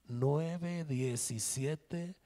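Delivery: very slow, drawn-out digits; slow motion. Shown as a demonstration. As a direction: spell each number out slowly. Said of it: "un cámara lenta".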